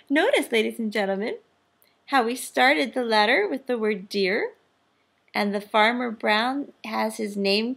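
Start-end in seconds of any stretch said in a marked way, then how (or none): none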